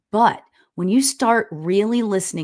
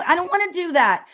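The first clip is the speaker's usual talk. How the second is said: put-on a higher, childish whine; whiny protest; 9 semitones higher than usual. Arguing with her spirit guides, whining. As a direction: protest like a sulky kid. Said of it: "we yell at my guides".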